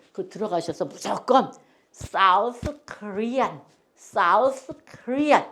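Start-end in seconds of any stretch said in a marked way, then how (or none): 1.9-5.4 South Korean said very slowly, word by word; slow, clear phrase. Shown as a demonstration. Teacher shows seniors what to say when lost abroad. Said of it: "요 말만 하시면 돼".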